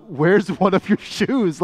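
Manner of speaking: slow, laughing retold question; laughing at the lost shoe; laughing mock alarm. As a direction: ask it through a laugh, mock shocked. Retold panicked question on subway.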